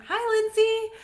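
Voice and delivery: high, loud sing-out hello; bright greeting. Real, not acted. Livestream host greeting a viewer who joins, calling out high.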